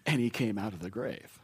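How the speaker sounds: ordinary delivery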